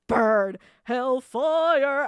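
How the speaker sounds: loud, drawn-out inner war cry; loud mock-villain thought; mock battle cry. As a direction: belt it out like a gleeful arsonist. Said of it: "I was either thinking".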